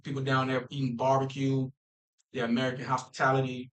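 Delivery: normal talking voice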